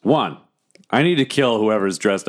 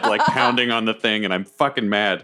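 ordinary talk

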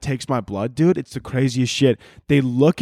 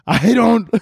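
high, loud, bursting into laughter; laughing; 9 semitones higher, 5 dB louder than usual. Real, not acted. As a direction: crack up while starting to speak. Cracking up at own bit.

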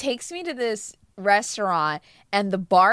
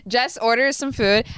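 normal talking voice

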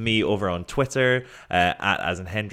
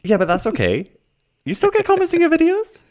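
much higher, louder teasing; 7 dB louder, faster than usual. Shown as a demonstration. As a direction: squeak it out teasingly. Teasing in high voice.

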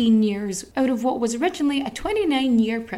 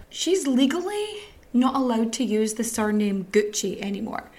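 ordinary talk

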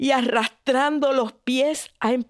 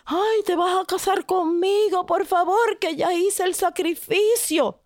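pleading maiden; 8 semitones higher than usual. Acted.

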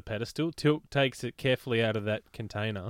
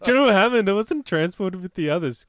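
mock Tilk voice; 9 semitones higher, 8 dB louder than usual. Voicing Tilk, high laughing.

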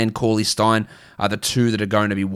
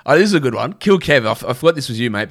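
ordinary delivery

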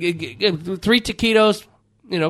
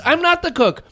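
ordinary talk, nothing marked

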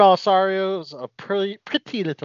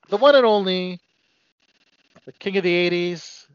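normal talking voice

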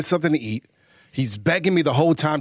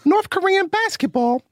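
son's voice; 12 semitones higher than usual. Pretend. Dad reading the question in his son's high voice. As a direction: read the question like a toddler. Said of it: "my son is so bad".

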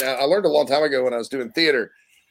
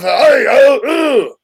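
high, loud, hammed-up Scottish brogue; Scottish accent; 11 semitones higher, 8 dB louder, much slower than usual. Shown as a demonstration. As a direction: belt out a cartoonish Scottish burr. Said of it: "that's Scottish".

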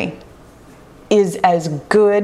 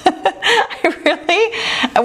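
laughing; 10 semitones higher, much slower than usual. Real, not acted.

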